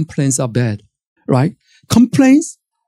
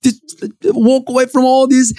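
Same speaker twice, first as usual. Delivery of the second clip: sulky walk-off; 7 semitones higher than usual. Acted. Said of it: "and chucking a fit".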